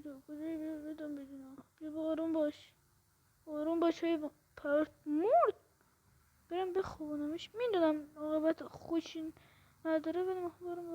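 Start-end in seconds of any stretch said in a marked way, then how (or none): none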